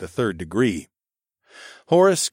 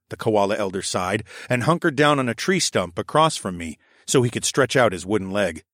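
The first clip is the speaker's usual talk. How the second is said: ordinary delivery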